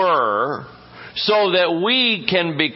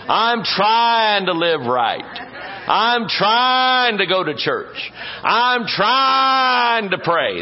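slow, higher, straining on each trying; strained stress on trying; 5 semitones higher, much slower than usual. Retold or imitated. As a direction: lean hard into the word trying, drawn out and climbing higher each time. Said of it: "they just really emphasize the word trying".

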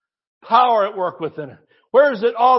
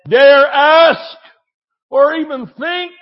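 preaching shout; 6 semitones higher, 5 dB louder, slower than usual. Real, not acted. Preaching emphasis.

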